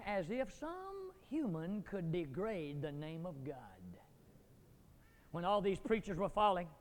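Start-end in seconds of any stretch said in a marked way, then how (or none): none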